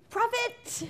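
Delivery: a gleeful cartoon flourish; punchline squeal. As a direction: pop it up high like a silly payoff. Comic punchline of gnome plan.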